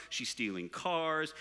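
usual speaking voice

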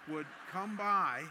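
slow, loud, drawn-out menace; ominous stress. Shown as a demonstration. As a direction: say it like a mob boss is coming. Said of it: "Doesn't it sound like that?".

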